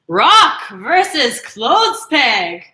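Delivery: hammed-up boxing announcer; fight announcer. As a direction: announce the bout like a ring host.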